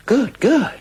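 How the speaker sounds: high, loud sarcastic "good, good"; mocking glee. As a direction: gloat at his discomfort. Mocking Adolf's complaint.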